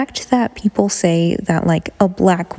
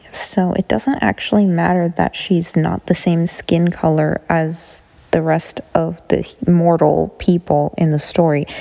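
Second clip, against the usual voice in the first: ordinary talk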